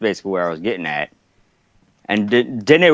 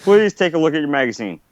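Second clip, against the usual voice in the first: higher-pitched exasperated request; mock pleading; 5 semitones higher than usual. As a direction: plead with a clueless officer, pitched up. Coach pleads with clueless lieutenant.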